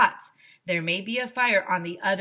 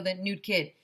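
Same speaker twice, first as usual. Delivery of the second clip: plain reading voice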